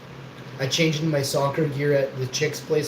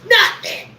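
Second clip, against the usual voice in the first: a much higher taunting yell, louder on the last word; gloating taunt shout; 9 semitones higher, 3 dB louder than usual. Retold or imitated. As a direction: shout it in their faces, peaking on Nothing. Taunting the opposing team.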